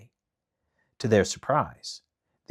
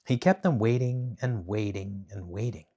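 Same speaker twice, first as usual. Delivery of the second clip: plain reading voice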